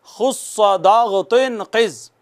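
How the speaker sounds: measured chant of the letters; slow recited letter group. Shown as a demonstration. Teacher slowly reciting खुस्सा दाग़ तुन क़िज़ for students. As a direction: recite each syllable deliberately. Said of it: "दोहराइएगा please".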